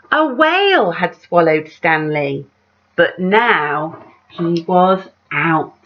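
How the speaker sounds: lively read-aloud with big rises on the refrain; story narration. A narrator's reading. Reading a picture book aloud to children, animated storyteller voice. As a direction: tell it like a bedtime picture book.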